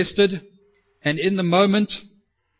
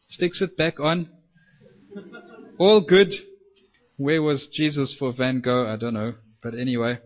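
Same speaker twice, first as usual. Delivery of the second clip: ordinary delivery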